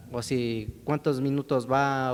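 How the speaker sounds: ordinary talk, nothing marked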